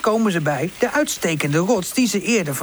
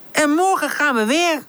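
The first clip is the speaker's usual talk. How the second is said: enthusiastic; 9 semitones higher than usual. Acted. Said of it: "roept Woezel enthousiast".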